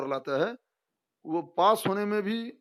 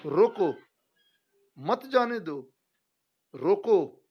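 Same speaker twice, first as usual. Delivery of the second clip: loud falling रोको, long pause, then मत जाने दो as one phrase; रोको stands alone as a command; pause after रोको; 3 semitones higher, 4 dB louder, much slower than usual. Shown as a demonstration. Teacher shows the second sentence, firm pause after रोको. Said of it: "विराम चिन्हों का कमाल देखिए".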